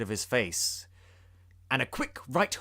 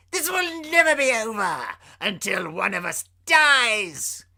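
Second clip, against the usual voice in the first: Joker voice; 8 semitones higher, 6 dB louder than usual. Acted. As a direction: threaten with gleeful menace. Joker's parting threat.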